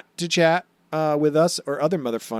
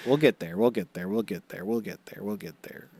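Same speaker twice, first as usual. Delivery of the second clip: each repeat softer and lower, fading out; fading repetition; 6 semitones lower, 7 dB quieter than usual. Acted. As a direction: let it trail away into nothing.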